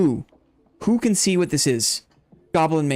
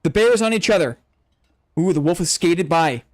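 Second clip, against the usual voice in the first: excited, higher fight commentary, building to the finish; play-by-play; 4 semitones higher than usual. Acted. Streamer calling the bear fight for chat, excited play-by-play. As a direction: call every hit like a ringside announcer.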